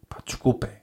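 breathy unvoiced sorry; a hushed, sheepish sorry; whispered apology. Real, not acted. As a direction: whisper the sorry, half-mocking.

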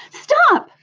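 very high, loud narrated cry; scream. A narrator's reading. Pig interrupts old sheep's account of the killing, screamed. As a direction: cut in with a high-pitched acted scream. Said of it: "screamed Wilbur".

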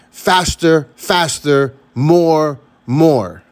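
mock-screamed urging, each word punched. Motivation screaming at people to speed up, mock scream. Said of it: "motivation screams".

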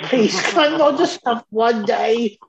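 high, slow mock plea; imitated begging mum. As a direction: play a worn-down mum pleading with a laugh. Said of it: "it was like she was begging us".

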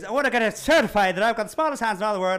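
mock boast pushed out at the listener; drunk dwarf. Drunk dwarf demanding a certificate, mock insistent. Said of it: "he is hammered".

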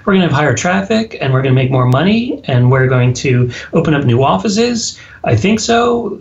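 an unsure CEO ending on a rising question tune; mock uptalk CEO. Imitating a CEO who uptalks to staff, rising. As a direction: make every claim sound like a question. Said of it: "if you sound like you're asking a question".

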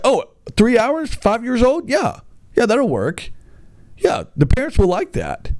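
high, sarcastic fake enthusiasm; mock the bosses with a bright sarcastic yeah; parodying clueless bosses, high and sarcastic; sarcastic mock approval. Mocking the bosses' idea.

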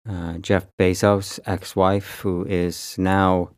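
ordinary talk

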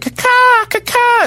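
very high cawing bird; crow caws. Retold or imitated. Birds mocking the traveller, high crow caws. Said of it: "They're like".

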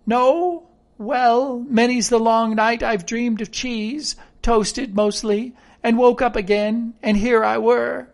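marooned castaway character. Acted. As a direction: dream aloud of cheese as a castaway.

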